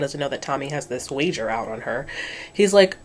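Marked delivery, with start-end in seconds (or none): none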